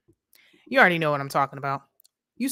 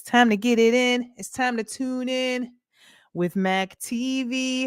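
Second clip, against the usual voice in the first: announcer voice; 7 semitones higher, slower than usual. Acted. Announcing a viewer's channel.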